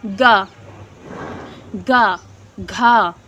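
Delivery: गा and घा low and buzzing; voiced consonant demo. Shown as a demonstration. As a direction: let the throat vibrate on गा, गा, घा. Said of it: "इनमें झंकृत हो रहा है".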